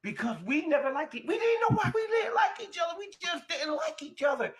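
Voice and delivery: very high-pitched, emotional outburst; overcome with emotion. Real, not acted. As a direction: let real emotion push the voice way up high. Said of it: "I'm getting emotional".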